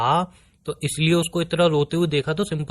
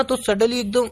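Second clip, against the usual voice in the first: flustered; 7 semitones higher than usual. Real, not acted. Boyfriend confesses what came out.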